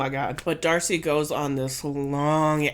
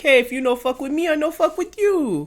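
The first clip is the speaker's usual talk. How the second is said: put-on high, broken-English Florian accent, pitched up; imitating his accent, much higher; mock Florian accent; 10 semitones higher, 4 dB louder than usual. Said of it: "He's like".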